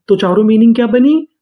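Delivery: usual speaking voice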